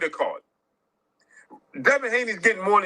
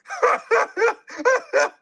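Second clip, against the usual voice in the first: high burst of laughter. Real, not acted.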